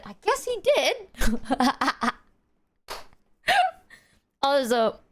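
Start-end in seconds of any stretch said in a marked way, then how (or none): 1.0-2.1 laugh. Real, not acted. Laughing at dad's gotcha.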